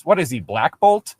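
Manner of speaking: ordinary talk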